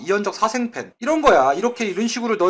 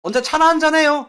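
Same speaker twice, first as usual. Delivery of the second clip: mock flirty invite; 8 semitones higher, 4 dB louder than usual. Acted flirty invite to a crush.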